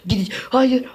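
high, sudden cry of no; startled high no; dismayed no. Real, not acted. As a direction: yelp it as the jump fails. Falls again in game.

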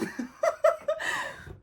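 high airy wheeze of a laugh; high breathy laugh. Real, not acted. Vlogger at her camera angle, high airy laugh. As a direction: laugh silently at your own shoulders. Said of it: "omuzlarım baksana ne kadar geniş çıktı".

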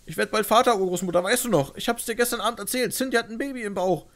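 loud, high tearful pleading; tragic heroine sorrow. Acted. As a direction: hold her back with hope. Pleading to dying great-grandmother.

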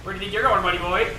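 loud taunt at an enemy pilot. Real, not acted.

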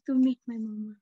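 usual speaking voice

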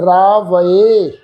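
ਰਾਵਏ said very slowly, "raa-va-e" with a separate final "e"; correct pronunciation. Shown as a demonstration. Teacher models the correct reading ਰਾਵਏ, slowly. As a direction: draw out ਰਾਵਏ as three clear syllables. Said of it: "ਇਸ ਢੰਗ ਨਾਲ".

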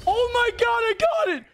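high, loud disbelieving cheer; excited shout. Real, not acted. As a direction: yell it like you won. Just won the round.

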